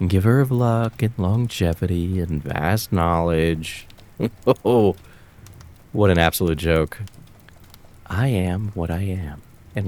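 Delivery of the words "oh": loud derisive laugh into an oh; scornful laugh. Acted. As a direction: cackle at the absurd legend. Scorning the fox legend.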